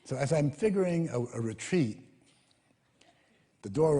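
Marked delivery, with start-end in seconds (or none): none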